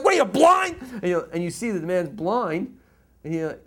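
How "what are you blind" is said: mock angry yell. Bystander yells at pusher.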